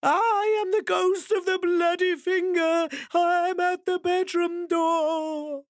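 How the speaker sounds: very high slow ghost wail; walking closer. Acted. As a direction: wail it right outside the door. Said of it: "the voice got closer and closer".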